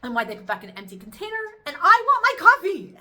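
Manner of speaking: indignant complaining, building to a loud, high outburst over the coffee; upset rant. Retold or imitated. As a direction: grumble about the inconsiderate kids, then blow up. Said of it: "And I was like".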